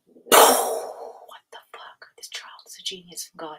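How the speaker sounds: whispered, drawn out in awe; stunned whisper. Retold or imitated. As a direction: whisper it as if floored. Stunned by son's insight.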